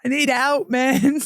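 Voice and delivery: half-laughing imitation of crying down the phone; retold crying plea. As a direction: replay the tearful pleading with a half laugh. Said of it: "Like, I was crying".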